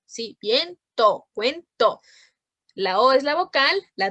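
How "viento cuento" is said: "Viento, cuento" with each final to set apart; measured beats, vien-to, cuen-to, for "Viento, cuento"; split into syllables. Shown as a demonstration. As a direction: pause inside "Viento" and "cuento". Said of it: "la vocal y la consonante van dadas".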